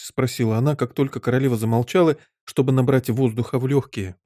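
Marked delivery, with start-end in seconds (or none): none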